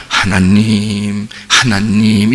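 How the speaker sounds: low, long-drawn reverent call; drawn-out, hammed-up piety; mock pious calling. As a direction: imitate a devout worshipper slowly calling out in prayer. Mimicking pious calling on God.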